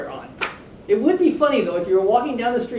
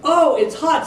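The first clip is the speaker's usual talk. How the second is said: hot lion sighing; 7 semitones higher, 5 dB louder than usual. A narrator's reading. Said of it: "sighed the lion".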